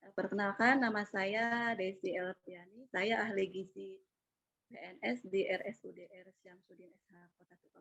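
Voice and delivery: faint and distant, too quiet; speaking too far from the mic; barely audible. Real, not acted. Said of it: "suaranya agak terlalu kecil".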